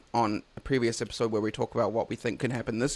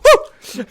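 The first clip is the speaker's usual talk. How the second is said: high-pitched whoop; high woo; 13 dB louder than usual. Real, not acted. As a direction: yelp at the heat. Spicy sauce reaction.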